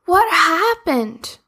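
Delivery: slow, high caring question; concerned. Acted. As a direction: ask gently what's wrong. Sophie asks the bear kindly.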